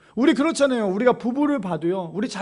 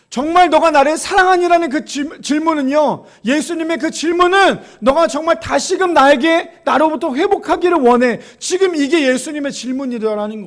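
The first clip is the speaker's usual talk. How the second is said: fervent preaching; 7 semitones higher, 8 dB louder than usual. Acted. Preacher at full force.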